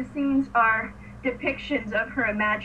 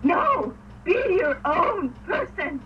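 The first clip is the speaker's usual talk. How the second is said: loud, higher mock scolding; raised voice, slowing for emphasis; exasperated outburst; 6 semitones higher, 4 dB louder, much slower than usual. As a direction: cry it out in fond exasperation at a character. Acted outburst at a show character.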